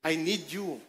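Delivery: low, soft and sincere; hushed, earnest i need you; tender to neighbour. Shown as a demonstration. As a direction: say it softly to the person beside you. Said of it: "tingnan mo yung katabi mo mabuti".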